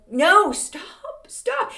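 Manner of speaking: high-pitched mock plea to stop; protesting. Homeowner begging a pricey trend to stop, mock protest. Pleading against trendy style.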